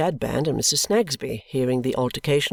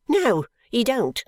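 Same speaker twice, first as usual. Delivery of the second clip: higher, slow, emphatic denial; sharp wife's cry; 10 semitones higher, slower than usual. A narrator's reading. Wife calling down to deny knowing the boy, sharp. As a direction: snap it down the stairs. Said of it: "instantly cries out".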